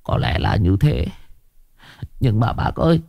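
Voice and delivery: softly, hushed; quiet voice. A narrator's reading. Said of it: "Nó nói nhỏ giọng". (nói nhỏ giọng)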